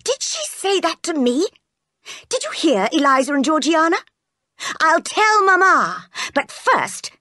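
high-pitched indignant tattling; outraged young master. Acted. John threatening to tell Mamma, outraged. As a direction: cry out like an affronted spoiled boy. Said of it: "he cried".

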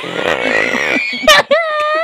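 high, squeaky wheezing cackle; wheezy laugh. Real, not acted. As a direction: laugh until the air runs out. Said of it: "You sound like Wheezy".

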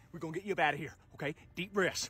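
low, soft reassurance; calm an injured player gently; soothing trainer. Acted.

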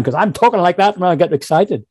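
ordinary delivery

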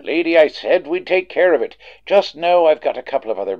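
exasperated desk sergeant ending the call; impatient snap. Acted. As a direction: shut her down firmly, losing patience. Impatient cop dismisses nagging caller.

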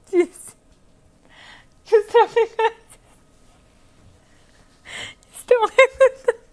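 laughing through the words, pitch climbing high; can't stop cracking up mid-sentence; hysterical laugh. Real, not acted. Anchor breaks into laughter reading.

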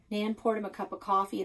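ordinary delivery

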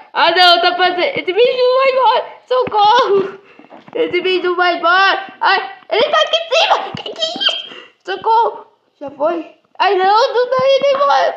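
panic-laugh as the creature stays; frantic scared squeals. Real, not acted. Creature won't go away. (gritos de pânico, agudos e rápidos)

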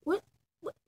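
normal talking voice

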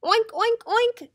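high, loud oinks; snorting pig. A narrator's reading. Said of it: "snorted Pig".

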